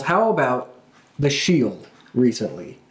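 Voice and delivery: usual speaking voice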